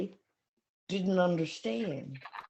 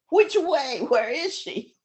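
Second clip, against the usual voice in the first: menacing demand; 5 semitones higher, 6 dB louder than usual. Retold or imitated. Man with knife demands.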